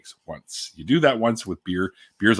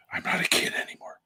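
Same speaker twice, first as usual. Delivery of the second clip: whispered aside; 9 dB quieter than usual. Real, not acted. Rueful aside after joke.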